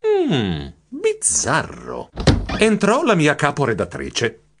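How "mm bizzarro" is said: pondering. A narrator's reading. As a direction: draw out the word as you mull it over. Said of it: "Io riflettei". (riflessivo, lento e sospettoso)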